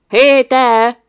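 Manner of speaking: the doctor calling to the distracted boy. A narrator's reading. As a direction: snap him out of his dream. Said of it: "szólt rá Gamberini".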